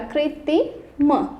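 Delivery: कृत्रिम drawn out slowly, each sound clear; कृत्रिम said very slowly and carefully; slow syllable-by-syllable demo. Shown as a demonstration. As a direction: stretch out कृत्रिम so children hear त्र. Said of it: "ता से त्रिश वाला".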